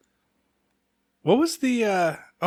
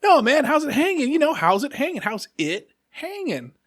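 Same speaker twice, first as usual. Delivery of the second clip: high, insistent mock kid voice; laughing, teasing friends; 12 semitones higher than usual. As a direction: imitate kids ribbing a clueless pal. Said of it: "they're laughing and they're like".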